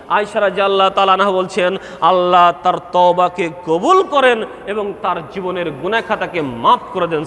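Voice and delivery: ordinary talk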